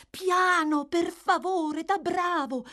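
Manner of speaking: high feminine voice, gently reproving. A narrator's reading. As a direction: voice a concerned young lady. Narrator voices the young lady.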